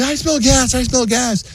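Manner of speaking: high-pitched imitation whine; mock whining. Husband imitating his wife's gas complaint, high mock whine. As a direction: whine it way up high, mocking her. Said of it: "then she starts whining".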